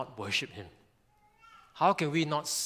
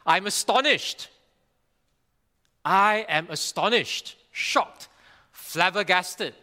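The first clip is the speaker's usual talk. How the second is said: shocked rebuke; 5 semitones higher, 5 dB louder than usual. Acted. Preacher voicing Paul rebuking the Galatians, astonished. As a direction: read Paul's shock with force. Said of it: "I'm trying to get the tone".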